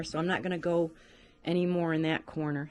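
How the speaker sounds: ordinary talk, nothing marked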